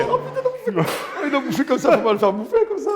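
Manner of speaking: high, laughing words; laughing at a friend's mistake. Real, not acted. Laughing at the guest eating.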